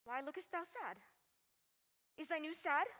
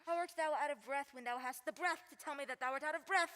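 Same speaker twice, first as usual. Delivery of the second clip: ordinary talk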